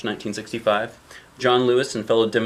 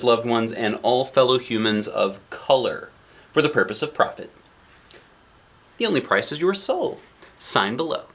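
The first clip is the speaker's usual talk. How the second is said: usual speaking voice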